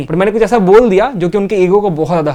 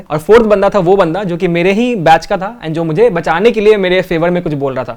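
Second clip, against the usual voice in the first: ordinary delivery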